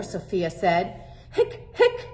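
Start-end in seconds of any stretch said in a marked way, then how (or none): 1.3-2.1 hiccup sounds, very high; squeaky hiccups. Acted. Hector hiccups.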